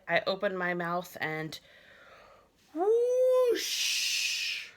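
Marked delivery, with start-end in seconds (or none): 2.7-4.7 much higher, louder whooshing sound effect; boy filling the hallway with a whoosh; big whoosh. Acted. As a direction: let a huge whoosh fly.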